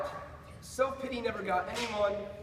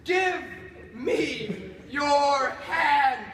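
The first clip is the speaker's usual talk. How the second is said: loud, slow, drawn-out order; booming command; 2 semitones higher, 6 dB louder, much slower than usual. Acted. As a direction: order the creature slowly, word by word. Commanding the standing creature.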